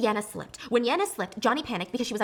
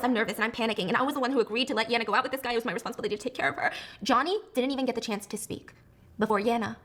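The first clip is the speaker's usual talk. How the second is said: ordinary talk, nothing marked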